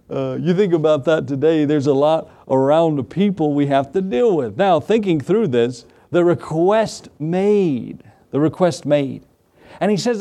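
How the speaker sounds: normal talking voice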